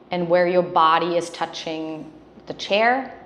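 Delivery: guided grounding. Acted. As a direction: ease them into their body. Leads a grounding exercise.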